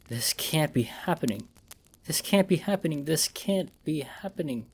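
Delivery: hushed, rising repetition; disbelieving muttering. A narrator's reading. Wounded man repeats in disbelief, hushed.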